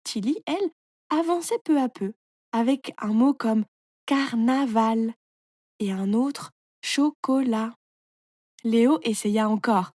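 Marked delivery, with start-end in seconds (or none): 4.0-5.1 "carnaval" slowly as car, na, val; three measured syllables in "carnaval". A narrator's reading. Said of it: "tu coupes le mot en petites parties". 6.8-7.7 "chocolat" slowly as cho, co, lat; three measured syllables in "chocolat". A narrator's reading. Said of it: "tu coupes le mot en petites parties".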